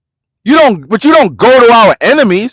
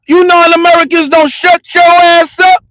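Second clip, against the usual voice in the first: full shout; 7 semitones higher than usual. Retold or imitated. Yelling at the non-Americans who threatened to call the cops, shouting. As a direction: let it rip at the top of your voice.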